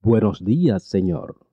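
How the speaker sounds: usual speaking voice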